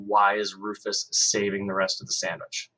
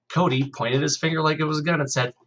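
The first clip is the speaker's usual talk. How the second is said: ordinary delivery, nothing marked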